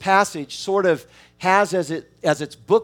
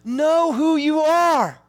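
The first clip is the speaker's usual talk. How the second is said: lightly voiced God, a touch higher and tender; as God, pleading with his children to see who they are; God gently urging his children, a little higher; God speaking to his children; 6 semitones higher than usual. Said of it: "God is saying to his children".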